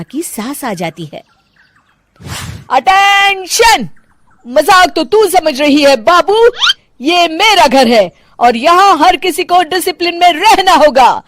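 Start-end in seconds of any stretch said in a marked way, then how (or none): 2.7-3.9 high, drawn-out slow parade-ground shout; mother-in-law calling the house to attention; drill command. Acted. Retired policewoman barks command. 4.5-11.2 higher, commanding mother-in-law voice; strict retired cop scolding her daughter-in-law; stern policewoman mother-in-law. Acted.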